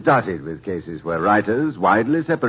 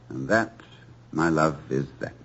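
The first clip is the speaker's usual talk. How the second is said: ordinary talk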